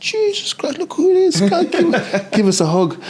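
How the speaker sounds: very high, excited exclamation breaking into laughter; delighted greeting. Retold or imitated. Guest greeting a chef he suddenly recognises, retold delighted excitement. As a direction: hail a friend with laughing, high-pitched delight.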